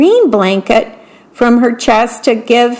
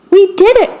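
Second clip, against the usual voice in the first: excited cry; 9 semitones higher, faster than usual. Acted. Toad, seeing the kite climb, cries out in high, happy triumph. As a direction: cheer it like the kite finally flew. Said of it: "cried Toad".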